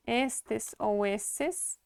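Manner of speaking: drawn out, every syllable clear; very slow careful listing. Shown as a demonstration. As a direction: stretch it so learners hear both. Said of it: "No geral".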